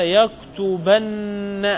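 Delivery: يكتبن drawn out as yaktubanna, long held nn; doubled final nun. Shown as a demonstration. Teacher asking for the form, يكتبن with a doubled -banna. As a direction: stretch يكتبن slowly, leaning on the double n. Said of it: "هنا تشديد".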